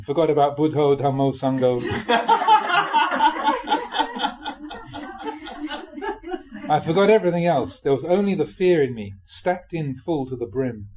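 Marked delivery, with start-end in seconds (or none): 1.8-6.6 a long high laugh rising at the end. Real, not acted. Monk laughing at forgetting his mantra, high laugh. 6.7-7.8 laughing. Real, not acted. Laughing still after the laugh.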